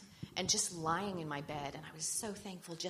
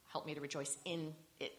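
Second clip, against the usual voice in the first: softer line, "in" picked out and held; stress on one word; 6 dB quieter than usual. Shown as a demonstration. Journaler praying to find joy, emphasizing the word "in". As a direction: set "in" apart from the rest. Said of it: "I would emphasize the word".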